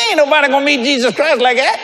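high-pitched, incredulous put-down; laughing, exasperated rebuke; exasperated scolding. Retold or imitated. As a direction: scoff at a feeble attempt, pitched high. Egging on timid friend to preach.

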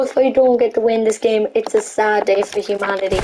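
normal talking voice

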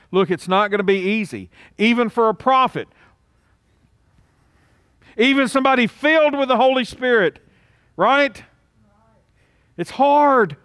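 high, rising sermon intensity; forceful preaching. Real, not acted. Preacher warns that choosing is hard even for prophets, forceful preaching. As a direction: build in pitch toward an emphatic finish.

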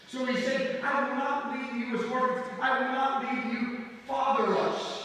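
loud, lifted sermon delivery; emphatic preaching. Real, not acted. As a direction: proclaim it as a firm promise. Preaches the promise.

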